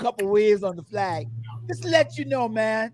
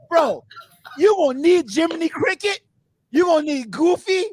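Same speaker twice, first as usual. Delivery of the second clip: taunting; 6 semitones higher than usual. Real, not acted. Taunting rival fan.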